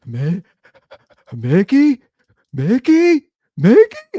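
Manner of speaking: rising squeaky calls, mock toddler amazement; toddler calling Mickey. Dad imitating his toddler calling to Mickey on TV, building to high loud squeals. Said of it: "She's like".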